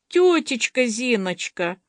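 put-on high, drawn-out childish pleading; little-girl voice. A narrator's reading. Masha begs her auntie for cake, in a child's voice. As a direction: put on a small child's voice, stretching the question. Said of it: "спросила Маша детским голосом".

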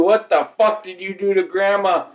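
high-pitched acted demand; outraged grandpa. Comic voicing grandpa finding grandma hurt, outraged demand. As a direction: confront them in comic alarm. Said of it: "Grandpa comes home from work".

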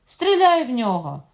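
high, very loud, insistent order; shouted command. A narrator's reading. Queen orders guards to fire on fugitive, shouted command.

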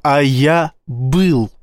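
slow, weighty statement. A narrator's reading. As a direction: drop each word with weight. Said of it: "веско сказал Боровский".

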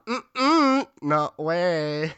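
high, far-off sassy robot chirp; sassy droid. Pretend. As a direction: scold like a cheeky little droid. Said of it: "I'll be a sassy droid".

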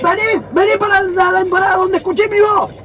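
high shout of a name into fog. Acted. Muguruza shouts for Fermín across the cloud. Calling partner through cloud.

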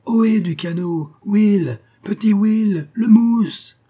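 high, loud call across the water; full-lung hail. Acted. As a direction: hail the boat with everything in your lungs. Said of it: "jetait à plein poumon son appel sonore".

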